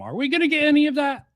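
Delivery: quick, high-pitched asking, jumping well up. Real, not acted. Commentator asking whether the video delivers, high quick questioning. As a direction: fire the question off high and fast. Same question asked twice, contrasting.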